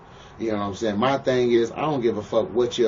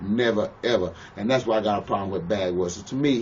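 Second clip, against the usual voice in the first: usual speaking voice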